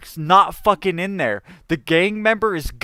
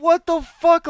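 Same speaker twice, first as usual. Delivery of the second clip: much higher, incredulous cry; shocked outburst; 10 semitones higher, slower than usual. Retold or imitated. While being tortured by the gangster, high and shocked. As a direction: shriek the disbelief.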